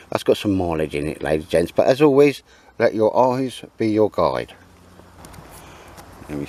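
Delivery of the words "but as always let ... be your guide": catchphrase sign-off. Acted.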